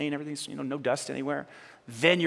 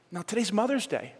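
ordinary delivery

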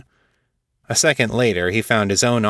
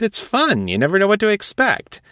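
playful teasing; 12 semitones higher than usual. Acted. Tara teasing Jessup.

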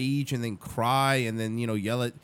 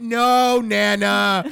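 loud, slow, drawn-out shout; mock yelling; 4 semitones higher, 7 dB louder, much slower than usual. Yelling at Nana in nursing home, mock shout. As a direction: holler as if to someone hard of hearing. Acted yelling at old person.

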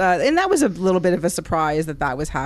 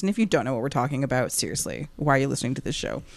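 fast aside; 5 semitones lower, 6 dB quieter, much faster than usual. Acted.

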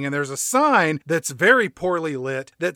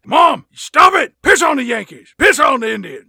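loud, higher yell at mother; unhinged yelling at Mom; Norman Bates; 7 semitones higher, 7 dB louder than usual. Acted. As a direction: shout at the voices in your head. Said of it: "like Norman Bates style".

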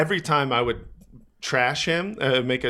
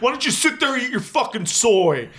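mock threat; 7 semitones higher than usual. Big guy threatening the comedian, high mock tough-guy voice. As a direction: ham up the big guy's threats. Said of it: "He's like".

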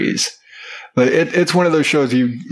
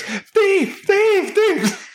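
high, sing-song thief thief thief; dad playfully crying thief at his son; mock accusing cry; much slower than usual.

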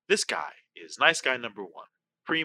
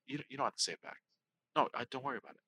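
soft, hushed reassurance, much softer; said quietly; 10 dB quieter, much faster than usual. Retold or imitated. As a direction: gently let him off the hook, barely above a murmur. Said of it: "then he just says quietly".

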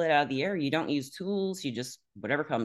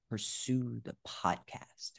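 slow, hushed oracle voice; mysterious message; 8 dB quieter, much slower than usual. Retold or imitated. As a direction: deliver it like a solemn prophecy. Friend delivers a psychic message.

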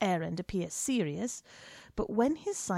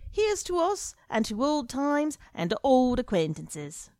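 a lightly voiced hearty, loud old friend; jovial journalist; 6 semitones higher, 4 dB louder than usual. A narrator's reading. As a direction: greet him boisterously over the bar.

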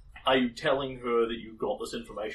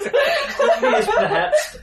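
loud, slow laughing line; 13 dB louder, much slower than usual. Acted. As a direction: laugh out the words.

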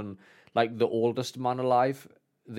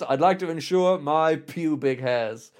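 put-on posh caller, higher and louder, slow; mock phone call; 4 semitones higher, 6 dB louder than usual. Kid pranking the insurance line, put-on caller voice. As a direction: play a cheeky kid faking a proper call.

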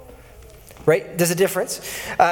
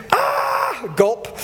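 mock shocked gasp. Imagining the crowd's shock.

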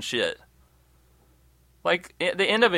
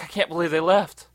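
mock bewildered; 5 semitones higher than usual. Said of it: "everybody's like".

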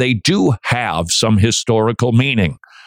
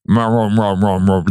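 low droning mock murmur of complainers; a low, grudging mutter-chant; mocking grumble; 3 semitones lower than usual. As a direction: drone it like a sulky crowd muttering. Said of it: "They were murmurers".

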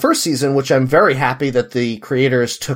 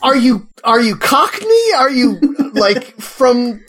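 rising, disbelieving questions climbing much higher; baffled mock outrage at an accent; exasperated pitch rising higher and higher; incredulous; 12 semitones higher than usual. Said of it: "and you're like".

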